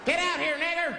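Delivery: high-pitched acted shout; mob yell. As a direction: yell like a threatening mob member. Mob member yelling in story.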